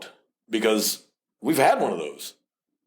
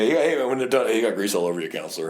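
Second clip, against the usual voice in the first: ordinary talk, nothing marked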